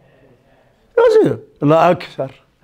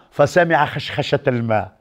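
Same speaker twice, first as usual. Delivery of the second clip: ordinary talk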